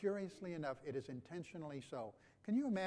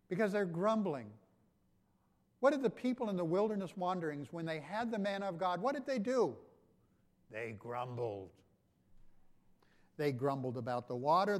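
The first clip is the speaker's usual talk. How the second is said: full-force preaching; 3 semitones higher, 6 dB louder than usual. Real, not acted. Pastor rousing the congregation about grumbling, loud preaching. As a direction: build the fire of the sermon.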